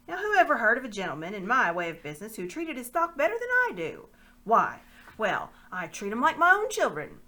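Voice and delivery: butter up the lord for a sale; fawning slaver. Acted. Pug flattering the lord to sell the boy, fawning. Slaver protests his kindness.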